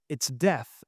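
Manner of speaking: usual speaking voice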